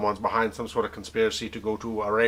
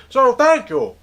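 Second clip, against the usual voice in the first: high thank you; 11 semitones higher, 6 dB louder, slower than usual. Acted. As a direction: jump way up on "thank you" with a loud, bright start. Host's sign-off thanks.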